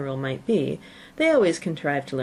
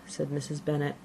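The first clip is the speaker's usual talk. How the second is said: ordinary delivery, nothing marked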